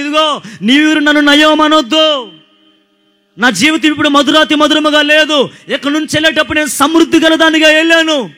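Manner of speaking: Naomi's lament. Acted. Preacher voicing Naomi's complaint against God, in a loud sung wail. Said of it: "Naomi ఎవరి మీద పెట్టింది నేరం".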